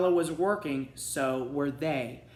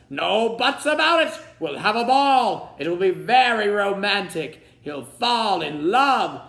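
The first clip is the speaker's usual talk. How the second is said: king's voice; 6 semitones higher, 9 dB louder than usual. A narrator's reading. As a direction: bellow like an impatient old king. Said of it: "the king shouted".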